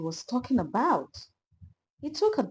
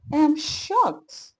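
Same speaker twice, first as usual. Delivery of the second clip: bright; 6 semitones higher, 3 dB louder, slower than usual. A narrator's reading. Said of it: "he said brightly".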